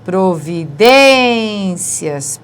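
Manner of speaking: Providências stretched very slowly; drawn-out word. Shown as a demonstration. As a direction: read Providências out syllable by syllable. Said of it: "paroxítona terminada em ditongo". (Providências bem devagar)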